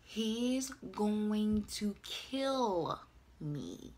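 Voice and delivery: very slow, drawn-out and sinking; dazed dread. Retold or imitated. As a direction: stretch each word out in mock doom. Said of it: "I'm just saying over and over".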